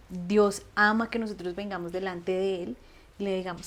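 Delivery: ordinary talk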